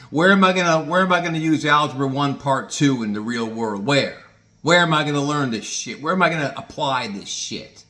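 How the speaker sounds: loud, high, mouthy student talking back; wiseass retort. Retold or imitated. Student wising off to his teacher, loud and mouthy. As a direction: sass the teacher hard, demanding an answer. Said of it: "I'd wise off to the teachers".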